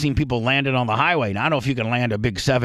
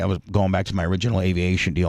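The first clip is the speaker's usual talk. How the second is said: usual speaking voice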